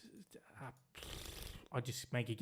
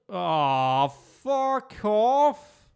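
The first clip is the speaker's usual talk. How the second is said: much louder and higher, swearing at the screen; frustrated outburst; 7 semitones higher, 10 dB louder, much slower than usual. Real, not acted. Annoyed at the game map, loud frustrated swearing. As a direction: blurt it out in real annoyance.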